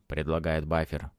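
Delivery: ordinary delivery, nothing marked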